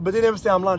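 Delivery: usual speaking voice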